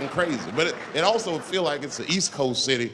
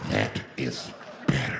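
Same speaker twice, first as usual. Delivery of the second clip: slow, low, nearly whispered; sinister calm; 4 dB quieter, slower than usual. Acted. Hostage-taker to the silent bus, slow low menace. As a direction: say it low and slow, savouring control. Flipped-out man settles, menacing.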